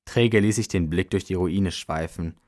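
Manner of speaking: ordinary delivery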